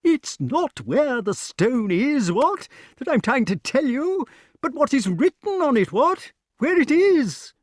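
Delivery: very high, emphatic squawk; getting excited. A narrator's reading. Pellinore struggles to tell about the writing, excited. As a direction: build to a loud, high insistence. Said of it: "getting excited again".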